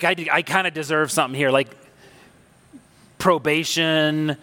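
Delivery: ordinary talk, nothing marked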